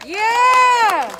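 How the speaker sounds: shouted yeah. Real, not acted. Rally roll call, crowd affirms.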